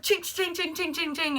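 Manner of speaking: high, ringing string of chings; imitated clattering. Storyteller mimicking molding falling off the wall, imitated clattering. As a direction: chime out each clatter up high.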